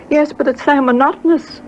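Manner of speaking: put-on high complaining girl's voice; a pleading daughter; whiny young girl. Gladys complaining to her mother.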